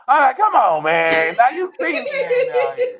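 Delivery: put-on high, loud kid voice; imitating his son. Five-year-old son grumbling from under his blanket, high and loud.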